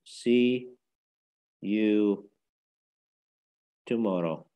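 "See", "you", "tomorrow" each separated by long pauses; word by word. Shown as a demonstration. Teacher modelling a goodbye for learners, word by word.

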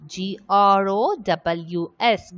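letter by letter, evenly spaced; spelling out letters. Shown as a demonstration. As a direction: spell each letter out separately. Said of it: "the spelling of the word".

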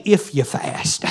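"If you fast." breathed almost without voice, slow and soft; hushed, drawn-out wrong reading; hushed mock if. Shown as a demonstration. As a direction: drop it to a slow, breathy near-whisper as the wrong version. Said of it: "He didn't say".

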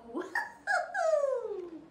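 high cackling laugh; godmother cackle. Acted. Godmother pleased with her magic, cackling. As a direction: let out a witchy cackle.

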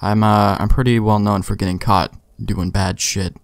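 normal talking voice

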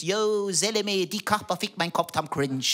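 lightly imitated slangy teen; mild mock youth slang; street slang youth. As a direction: drop into the kid brother's street talk. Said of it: "er mit vier seiner Jungs".

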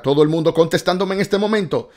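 excited host buzz. Real, not acted. Excited host reading live answers.

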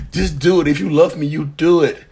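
high, loud, pushy demand; acting demanding girlfriend. New girlfriend pressures her flying monkey, high loud demand. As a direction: push him hard, high and loud. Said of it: "you're acting and cracking me up".

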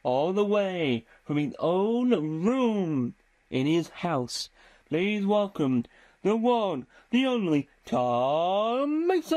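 mock game-show host welcome; hammed-up announcer. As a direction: announce yourself like a stage compere, stretching every word. Mock showman introducing himself.

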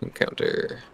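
normal talking voice

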